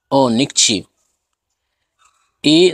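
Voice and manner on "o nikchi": nikchi said with no U at the end; U dropped. Shown as a demonstration. Teacher showing students the past tense said with the U left out. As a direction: cut the word off clean at nikchi. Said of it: "si queremos omitir su sonido".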